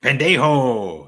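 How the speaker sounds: slow, low, loud Spanish insult; mock Spanish cussing. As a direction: spit out a slow Spanish curse.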